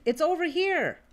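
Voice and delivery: high, eager call of welcome; excited exclaim. Acted. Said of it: "excitedly exclaimed".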